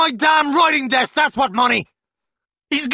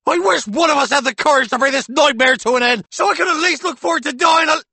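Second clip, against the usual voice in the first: high, loud roaring rant; roaring back; 9 dB louder than usual. A narrator's reading. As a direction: roar it back at her. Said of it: "The man roars back".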